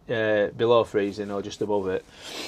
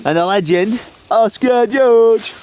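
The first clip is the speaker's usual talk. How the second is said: announcer intro; 10 semitones higher, 9 dB louder, slower than usual. Pretend. Hyped intro of the son.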